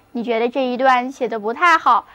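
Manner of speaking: usual speaking voice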